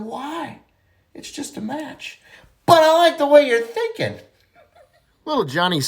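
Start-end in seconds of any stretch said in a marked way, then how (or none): 0.0-2.2 high, soft kid voice, playing innocent; a kid acting innocent with a quiet high voice. Johnny tells the teacher, innocent high kid voice. 2.6-4.2 high, laughing through the teacher's catchphrase; laughing punchline. Acted. Johnny mocks the teacher's catchphrase, laughing.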